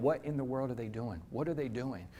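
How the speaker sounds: soft, baffled murmur among rivals; puzzled mutter. Retold or imitated. Competitors watching the training, mildly puzzled and hushed. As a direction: mutter it like a confused onlooker. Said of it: "All the competitors are going".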